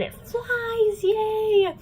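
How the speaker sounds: high, drawn-out mock yay; gleeful cheer. Recapping the motorcycle taking flight, gleeful cheer. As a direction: stretch it into a squealed cheer.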